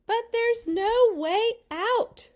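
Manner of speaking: frightened people crying out; crying out in despair. Acted. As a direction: plead it with no hope left. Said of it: "they cried".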